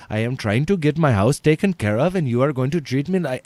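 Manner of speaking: fast, indignant put-on Indian accent; East Indian accent. Prank caller complains to the owner about service, East Indian accent. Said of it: "I was a very East Indian man".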